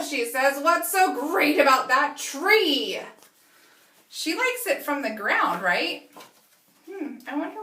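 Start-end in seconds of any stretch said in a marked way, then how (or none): 0.6-3.0 loud, slow demanding question; frustrated. Acted. Lila grumbling about the hippo's tree, loud and slow. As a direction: sulk and demand. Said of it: "she's super having a hard time".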